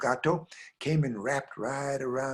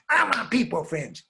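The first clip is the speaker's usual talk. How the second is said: put-on higher child's demand; mock wail of a little girl; 5 semitones higher, 4 dB louder than usual. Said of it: "And the way she said".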